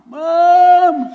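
put-on high, loud child's wail for mother; hammed-up kid's cry; imitated crying kids. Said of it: "my kids are all out there crying".

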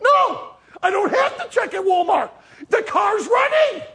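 high, loud exasperated shouting; all upset. Retold or imitated. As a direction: cut your wife off with a frantic yell. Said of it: "and I'm all upset".

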